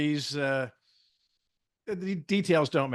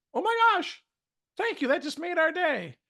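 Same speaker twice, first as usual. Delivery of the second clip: a much higher, gushing "Oh my gosh, thank you"; delighted parent; 13 semitones higher than usual. Retold or imitated. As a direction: play the flattered mom, touched and thrilled. Parent reacting to the compliment.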